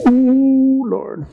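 high drawn-out ooh, dropping away; a light, daunted ooh sinking to softness; nervous Joshua. Acted. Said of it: "Joshua was like".

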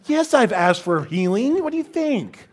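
loud, high-pitched mock outrage; comically indignant yell; exasperated retort. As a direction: burst out sarcastically, rising high. Sarcastic retort to obvious question.

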